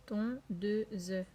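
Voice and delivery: "Tondeuse" split as ton, deu, ze; separated syllables in "Tondeuse", final e sounded. Shown as a demonstration. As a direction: break "Tondeuse" into three even beats. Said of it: "il a aussi trois syllabes".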